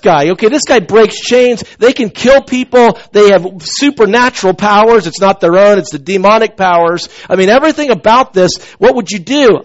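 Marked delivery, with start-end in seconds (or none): none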